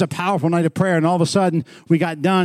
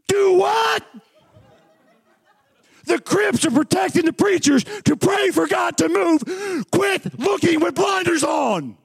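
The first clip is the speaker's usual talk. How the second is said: very high, drawn-out pulpit cry; excited preacher's shout; 12 semitones higher, slower than usual. Real, not acted. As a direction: cry it out slowly to the whole congregation. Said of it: "sometimes I get excited".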